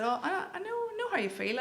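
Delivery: high-pitched start, settling as it goes; eager agreement. Retold or imitated. Agreeing with a stranger about home, eagerly sympathetic. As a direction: jump in high to agree, then ease down.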